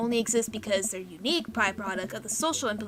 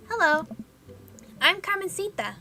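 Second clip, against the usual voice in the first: put-on little girl voice; 9 semitones higher than usual. Young girl introduces herself.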